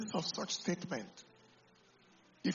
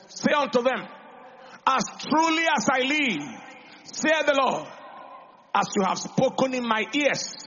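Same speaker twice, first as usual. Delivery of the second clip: loud, ringing pulpit delivery driving each phrase; full-force preaching; 3 semitones higher, 8 dB louder than usual. Acted. Pastor declaiming Numbers to the congregation, full-force preaching. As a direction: preach it out to the whole hall, louder.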